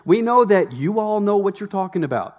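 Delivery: mock Paul as he teases know-it-alls; sarcastic Paul. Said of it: "I can almost hear the sarcasm in Paul's writing".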